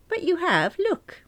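high, insistent correction; high, eager contradiction; gleeful insistence. Acted. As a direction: press the point, pitched right up. Friend insists, showing title page.

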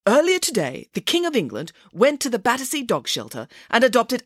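lightly voiced, self-assured newsreader pig; Nanny Piggins reading the news. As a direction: deliver made-up news with lively conviction.

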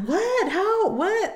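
high-pitched disbelieving questions; mock astonished kids; imitating stunned classmates. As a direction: squeak each question in amazement. Acting stunned schoolmates.

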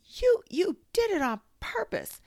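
very high, loud stammered outrage; squeaky indignant splutter. A narrator's reading. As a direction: burst out in broken, accusing squeals. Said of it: "spluttered Piglet".

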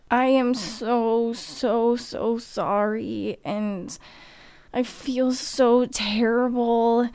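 slow, tear-choked apology; tearful apology. Retold or imitated. Apologizing to the man she hit, holding his face, tearful. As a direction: drag the sorrys out through tears. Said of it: "had tears in my eyes going".

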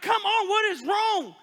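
high, loud urging plea; loud pleading encouragement; rousing exhortation. Retold or imitated. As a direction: push them up out of the pit.

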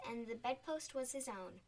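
ordinary delivery, nothing marked

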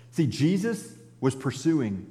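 usual speaking voice

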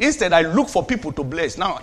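normal talking voice

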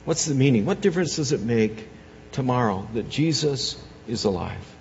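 usual speaking voice

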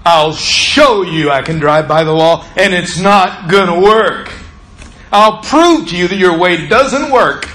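forceful, emphatic retort, rising in pitch; defiant. Retold or imitated. Driver promising to prove the ride-along wrong, forceful and defiant. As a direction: vow to prove them wrong.

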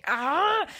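frustrated cry. Real, not acted. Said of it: "me acuerdo y me".

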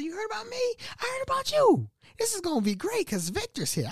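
high squeaky starstruck gushing; giddy fanboy. Retold or imitated. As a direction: gush like meeting a hero. Fanboying meeting Victor.

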